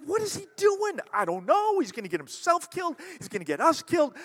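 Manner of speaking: high, loud alarmed voices; frantic, scared rising pitch; panicked followers. Retold or imitated. Followers panicking about Jesus, loud and high. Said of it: "They're like".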